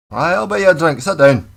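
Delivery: loud, high Scottish pub voice; Scottish man offering a pint, loud and gruff. Retold or imitated. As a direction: bark it like a Glasgow local offering a pint. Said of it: "that does sound a little aggressive".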